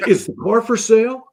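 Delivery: high, loud, bursting with eagerness; freaked-out buyer. Retold or imitated. Collector spotting the car he wants, loud excited blurt. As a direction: blurt it out like he can't believe his luck. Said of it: "he about freaks out".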